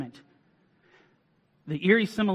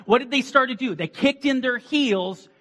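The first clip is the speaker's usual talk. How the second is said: loud, high-pitched preacher's cry; fiery preaching; 6 semitones higher, 6 dB louder than usual. Acted. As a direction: preach it out with full force. Preaching at full force.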